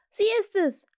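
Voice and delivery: high, loud excited cry; calling out. A narrator's reading. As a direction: cry out the discovery. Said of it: "rief er".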